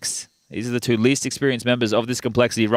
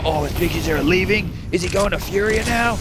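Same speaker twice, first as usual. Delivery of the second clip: higher, gossiping fan; mock fan voice; 7 semitones higher than usual. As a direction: play a worried fan asking. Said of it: "people would go".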